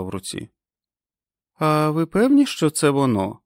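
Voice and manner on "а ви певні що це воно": високе недовірливе запитання; засумнівайся, піднявши голос; із сумнівом. A narrator's reading. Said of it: "з сумнівом спитала вона". (питає з сумнівом)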